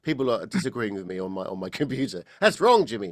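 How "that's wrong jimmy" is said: higher, louder playful objection; mock heckler. Coach voicing the people disagreeing on his computer, mock objection. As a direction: pipe up as a joking critic, high and loud. Voices beeps from disagreeing listeners.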